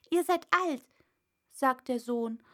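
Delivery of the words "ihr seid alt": put-on higher, louder young son's voice; cheeky son. Son teasing his old father, in a higher voice. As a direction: tease the old man.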